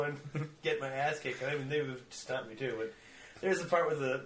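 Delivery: normal talking voice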